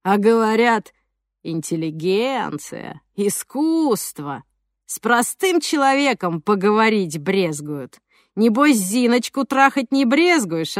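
slow, sneering street thug; menacing thug voice. Acted. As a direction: drawl it like a yard bully picking a fight. Thug Zhokh mocking.

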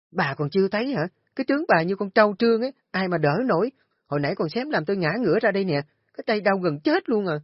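high, loud and fast, spitting scorn; screeching rant. Acted. Daughter-in-law shrieks at her fallen mother-in-law, loud and fast. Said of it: "Kim dậy nảy la lên".

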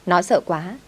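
plain reading voice

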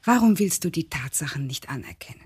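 hushed pressing questions; whispered. Acted. Wife confronts her husband in a whisper.

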